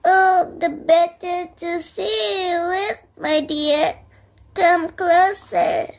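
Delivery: a hint of a wolf in granny voice, slow on come closer; wolf luring the girl nearer; lightly voiced wolf granny. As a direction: coax her closer. Wolf answering as grandmother.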